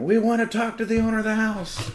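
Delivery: very high, loud imitated cop scream. Cops in the driveway demanding the owner, screamed. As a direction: holler the demand at full pitch. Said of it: "the cops are screaming".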